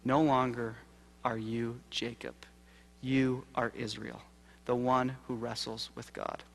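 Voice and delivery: slow, soft and solemn; the angel renaming Jacob, hushed and slow; solemn pronouncement. Acted. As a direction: bestow the new name gravely and gently. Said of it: "looks at him and says".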